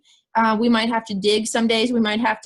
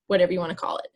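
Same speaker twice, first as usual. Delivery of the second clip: breathy throwaway whisper; whispered aside; 4 semitones lower, 4 dB quieter, much faster than usual. Real, not acted. Joking aside about downtime.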